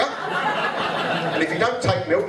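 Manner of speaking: very slow, stretched-out setup; deliberately slow build; drawn-out setup. Acted. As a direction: drag it out for the laugh.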